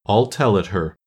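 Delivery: usual speaking voice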